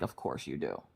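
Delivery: low, very soft dry retort; muttered aside. Retold or imitated. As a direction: mutter it under your breath, very low. Dry aside to Minna's dad's admiration.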